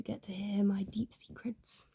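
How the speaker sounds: conspiratorial whisper; whispered secret. Pretend. Rewarding viewers who watched this far, whisper. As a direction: let viewers in on a secret softly. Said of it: "If you made it this far".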